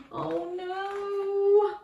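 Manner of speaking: high alarmed oh no; dismayed. Acted. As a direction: gasp out the disaster. Balloon crashes into the sea.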